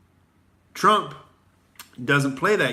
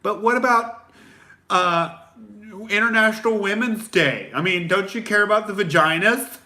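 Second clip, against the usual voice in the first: high, loud mock-outraged voice pitched way up; mocking whiny critic; 7 semitones higher, 4 dB louder than usual. Comic mocking the critics in a mock outraged whine. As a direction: sneer at them in a squeaky whine. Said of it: "And people are like".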